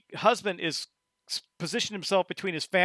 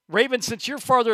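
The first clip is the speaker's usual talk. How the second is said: usual speaking voice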